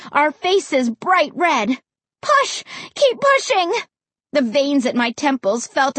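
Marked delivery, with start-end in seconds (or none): 2.2-3.7 high-pitched straining commands; frantic, insistent urging; urgent cry. A narrator's reading. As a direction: spur them on mid-effort. Urging brother on while shoving tree.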